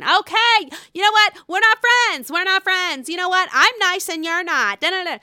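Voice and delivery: mock a bitchy friend breakup, voice way up; catty quote. Mimicking Jennifer telling Dolores off, high catty. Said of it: "Jennifer, I need to talk".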